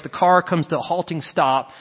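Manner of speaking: ordinary talk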